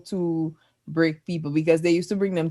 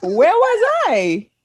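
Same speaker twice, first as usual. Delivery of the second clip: surprised; 9 semitones higher, 5 dB louder, much slower than usual. Real, not acted. Surprised, demanding details.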